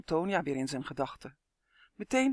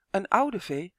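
ordinary delivery, nothing marked

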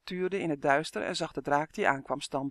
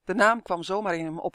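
ordinary delivery

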